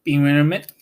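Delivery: ordinary delivery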